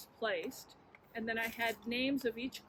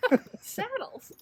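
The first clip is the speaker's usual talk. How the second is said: laughing; 9 semitones higher, 6 dB louder, slower than usual. Real, not acted. Laughs at the punchline.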